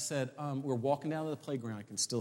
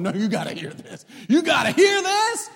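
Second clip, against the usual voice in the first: preacher's jubilant holler, pitched way up; exultant shouting; 13 dB louder than usual. Acted. Employee telling his boss what God did, shouting in triumph. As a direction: build to a high, full-voiced shout.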